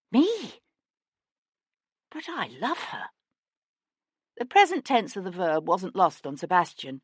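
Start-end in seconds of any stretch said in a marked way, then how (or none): 0.1-3.1 shocked. Acted. Bayard shocked, protesting.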